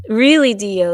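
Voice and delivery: loud, incredulous question; calls out a viewer in disbelief; mock disbelief. Real, not acted. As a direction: viewer comment read aloud, incredulous. Reacting to a comment.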